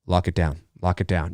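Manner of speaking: low, hushed urgent order; cop orders. Retold or imitated. Police at the crime scene, low urgent order. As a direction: order the lockdown low and tight. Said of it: "And, and are like".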